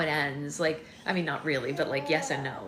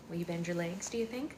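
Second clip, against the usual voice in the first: normal talking voice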